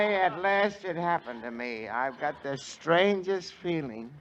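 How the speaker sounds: usual speaking voice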